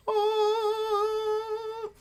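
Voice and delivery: sky-high delighted gasp; a soaring ah of joy. Real, not acted. Fan seeing her favourite wrestler gasps in delight. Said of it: "like the heavens parting".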